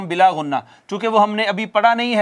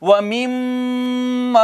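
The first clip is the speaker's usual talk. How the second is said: slow, drawn-out chanted recitation; Quran recitation; 2 semitones higher, much slower than usual. Shown as a demonstration. Teacher reciting the verse for students, slow chanted recitation. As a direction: recite each word slowly, stretching the vowels. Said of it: "teacher recites for students".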